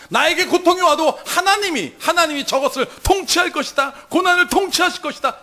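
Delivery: ringing, fervent declaration of faith; Daniel's bold declaration. Acted. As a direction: cry it out as Daniel in the lions' den.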